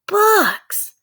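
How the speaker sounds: high, loud excited shout. A narrator's reading. Sister shouting from the treehouse window, high loud. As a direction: shout the discovery with glee. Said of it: "she shouted".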